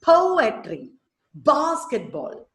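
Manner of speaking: POetry, BASketball, strong start then two light syllables; first-syllable stress. Shown as a demonstration. Teacher gives dactyl examples, stressing first syllables of poetry, basketball. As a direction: hit po in poetry and bas in basketball. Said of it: "Dactylic has stressed, unstressed, unstressed".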